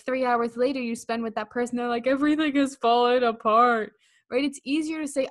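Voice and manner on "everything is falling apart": mock breakdown. Same friend breaking down hours later.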